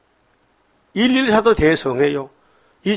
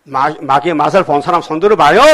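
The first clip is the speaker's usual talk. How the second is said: ordinary delivery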